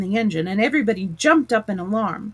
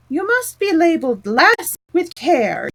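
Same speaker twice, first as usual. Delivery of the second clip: higher, louder, slow call; distant voice; 8 semitones higher, 5 dB louder than usual. A narrator's reading. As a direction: call it from across the carriage. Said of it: "A voice in the distance said".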